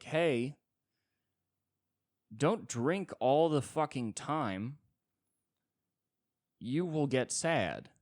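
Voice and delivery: slow mocking sermon of a pushy friend; mock preachy advice. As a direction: preach it slowly like a scold. Quoting therapist advice mockingly.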